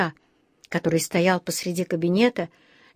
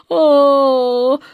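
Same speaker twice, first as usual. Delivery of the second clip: long, loud, higher wail; answering "oooh"; 6 semitones higher, 7 dB louder than usual. A narrator's reading. Bear answering from the dentist's chair, loud wail. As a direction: call back with mouth wide open. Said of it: "отозвался Паддингтон".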